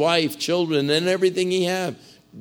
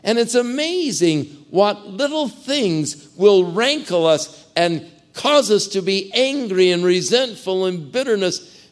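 higher and louder, driving the point home; impassioned preaching; 6 semitones higher, 4 dB louder than usual. Acted. Preacher scolds the church for petty grudges, fervent. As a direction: preach it hard to the congregation.

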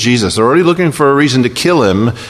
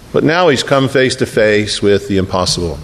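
ordinary talk

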